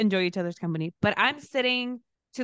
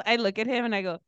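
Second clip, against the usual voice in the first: ordinary talk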